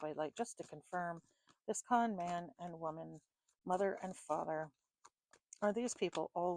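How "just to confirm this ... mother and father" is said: normal talking voice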